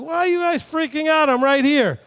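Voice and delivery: high-pitched, exasperated reassurance; incredulous questioning. Acted. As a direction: act out baffled disbelief at their panic. Voicing Yeshua questioning panicking apostles.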